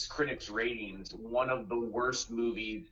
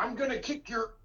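high-pitched hammed-up warning; mock threat; 11 semitones higher than usual. Imitating a preacher chasing someone, mock threat. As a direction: threaten comically in a raised voice.

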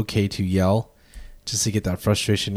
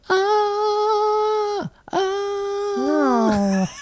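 shrill roller coaster scream; high pitched yell. Shown as a demonstration. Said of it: "That's how I yell".

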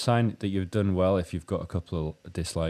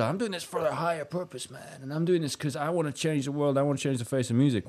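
high, softer voice of a starry-eyed musician; mock idealist dreamer; 5 semitones higher, 4 dB quieter than usual. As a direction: lightly send up a grand mission. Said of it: "if you think so".

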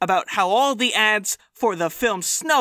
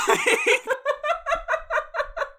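high villain laugh. Retold or imitated. Mulaney gloating at the camera, high cackle.

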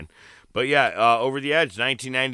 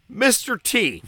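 hyped announcement; 11 semitones higher than usual. Acted. Announcing cast.

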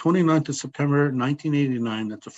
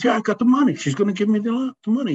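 much higher and louder, thrilled retelling; excited; 10 semitones higher, 4 dB louder, faster than usual. Retold or imitated. Friend repeating to Teddy that the bank gave him money, thrilled. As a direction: gush the news as if you can't contain it.

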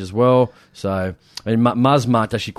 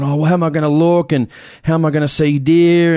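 higher, louder whiny complaint; mock whine; 5 semitones higher, 4 dB louder than usual. Mate complaining he can't see deer, mock whine. Friend whining about binoculars.

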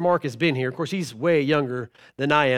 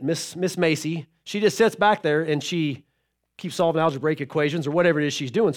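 ordinary talk

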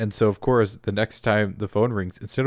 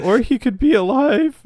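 much higher, imitated partner voice; hopeful objection; 13 semitones higher, 4 dB louder than usual. Partner clings to hope about missing deputy, mock hopeful.

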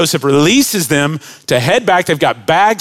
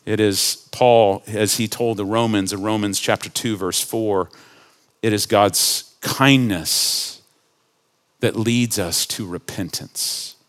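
tender sermon close; 6 semitones lower, 6 dB quieter than usual. Acted. Preacher gently urging the congregation toward repentance, softly. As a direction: ease down and speak gently of God's kindness.

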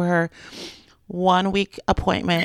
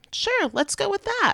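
breezy shrug; 12 semitones higher than usual. Retold or imitated. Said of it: "I'm like".

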